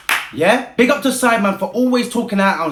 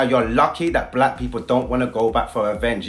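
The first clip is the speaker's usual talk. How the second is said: "you're lucky" said much lower and softer, menacing; low and quiet; 10 semitones lower, 5 dB quieter than usual. Real, not acted. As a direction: drop the voice down low for the warning. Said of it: "And I'll say it again".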